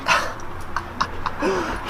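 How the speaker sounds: soft high giggling; high, quiet fit of giggles. Real, not acted. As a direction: laugh lightly on the breath. Laughing at the dog.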